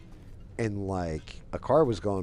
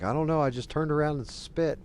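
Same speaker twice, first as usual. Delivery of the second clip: high, louder imitation of a baffled friend; retold rattled reply; flustered protest; 8 semitones higher, 3 dB louder than usual. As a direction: act out a bewildered defense.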